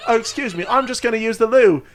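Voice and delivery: playful mock-British politeness; British accent. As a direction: put on a hammed-up British voice. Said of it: "Why are they British?".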